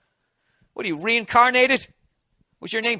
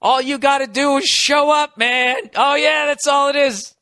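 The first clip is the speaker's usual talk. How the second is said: mocking other comics; 7 semitones higher, 3 dB louder than usual. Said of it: "they just go".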